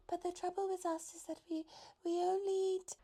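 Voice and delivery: high, light giraffe voice, politely worried; high fretful giraffe voice. A narrator's reading. Giraffe character voice.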